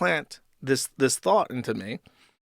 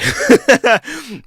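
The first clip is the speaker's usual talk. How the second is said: a high burst of laughter at the absurdity; amused laugh; 11 semitones higher, 9 dB louder than usual. Real, not acted. Laughing at liars who lie about nothing.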